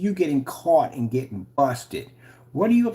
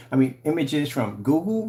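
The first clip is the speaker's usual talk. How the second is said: ordinary talk, nothing marked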